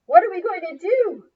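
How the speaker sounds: high, loud desperate question; panicked question. Acted. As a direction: beg for an answer in panic. Trapped by lava.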